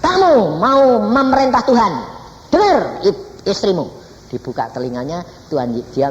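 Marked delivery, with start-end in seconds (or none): 0.0-4.0 high, louder rebuking voice of God; stern God. Retold or imitated. God rebuking the grieving father, high and louder. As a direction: rebuke him from above. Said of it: "Dia bilang".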